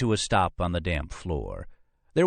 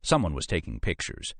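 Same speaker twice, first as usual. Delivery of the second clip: usual speaking voice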